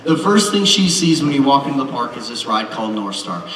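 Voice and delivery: ordinary talk, nothing marked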